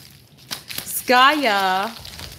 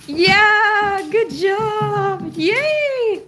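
higher and louder, sing-song cheering; excited sing-song praise; 9 semitones higher, 4 dB louder, much slower than usual. Real, not acted. Cheering the dog.

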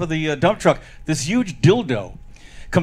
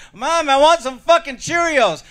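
put-on high, drawn-out child's demand; demanding bratty kid; 10 semitones higher, 4 dB louder, slower than usual. Kid defying his mother at breakfast, loudly insistent. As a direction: stretch it out slowly, pitched way up.

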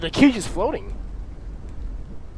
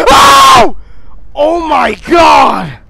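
high gasping scream, drawn out; startled scream; 7 semitones higher, 12 dB louder, much slower than usual. Real, not acted. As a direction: shriek from a jump scare. Friend sneaks up behind.

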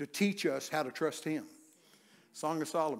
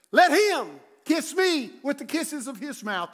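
higher, louder, slow reading of the verse; slow scripture proclamation; 9 semitones higher, 9 dB louder, slower than usual. Acted. Preacher reciting Song of Solomon, slow loud proclamation. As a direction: declaim the verse slowly, loud.

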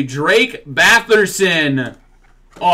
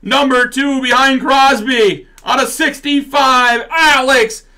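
excited; 8 semitones higher, 4 dB louder, slower than usual. Real, not acted. Excited pull, mock announcing.

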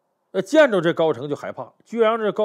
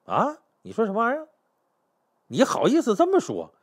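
high, indignant comeback; flaring up angrily; 7 semitones higher than usual. Acted. General rounds on the emperor, indignant. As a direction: throw it back in outraged disbelief. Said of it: "高澄急眼了".

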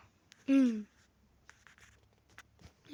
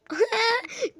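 sudden loud high laugh; 9 semitones higher, 7 dB louder than usual. Real, not acted. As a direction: burst out laughing. Reacts to enemies fighting. (yüksek sesli ani kahkaha)

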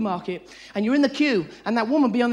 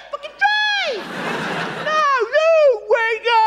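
shouting at an object; 12 semitones higher, much slower than usual. Acted. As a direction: yell blame at the tray, voice pitched high. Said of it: "They're shouting in a, inanimate object".

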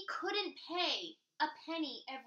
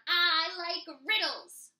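loud, slow gleeful troll; excited troll; 5 semitones higher, 10 dB louder, slower than usual. Acted. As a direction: say it bouncing with glee. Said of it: "jumping up and down".

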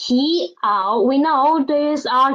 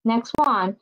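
ordinary delivery

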